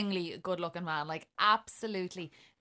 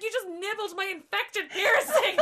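laughing; 12 semitones higher, 6 dB louder than usual. Retold or imitated. Retold outrage at the date.

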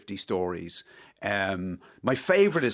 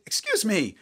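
higher, mild mock shout; retold shout; 10 semitones higher, slower than usual. Merkel calling after the passing stranger, mild mock shout. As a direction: call out sharply, half-hearted. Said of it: "So she shouted".

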